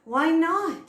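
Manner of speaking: loud rising astonished why not; surprised. Retold or imitated. Guru surprised at disciple's fearlessness, loud astonished question. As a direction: eyebrows up in surprise. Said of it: "Gurudev's eyes got really big".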